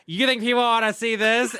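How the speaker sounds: mock Tyra's scornful question; mimicking Tyra. Tyra scolding the model about her gap, mock-scornful. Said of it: "I'm gonna mimic it".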